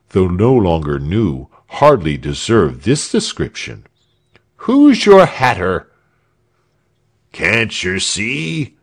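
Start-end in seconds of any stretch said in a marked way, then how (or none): 4.6-5.9 high, loud taunting shout; mock the guard's hat; jeering. A narrator's reading. Crowd taunting the silent guards, a loud high jeer. 7.3-8.7 slow, mocking heckler voice; a hint of a cheeky commoner; shouted. A narrator's reading. A friend heckling the silent Frieshardt, mild mocking call. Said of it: "shouted a friend".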